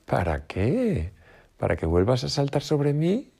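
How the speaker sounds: slow, high, teasing; sly rabbit enjoying the fox's trouble; smug smile. A narrator's reading. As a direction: tease him with a grin. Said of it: "con una sonrisa".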